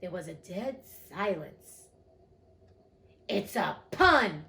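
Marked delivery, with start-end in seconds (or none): 3.3-4.5 loud, slow, put-out insistence; offended. A narrator's reading. The king to a silent court, offended. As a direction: spell out the joke loudly, affronted. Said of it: "added in an offended tone".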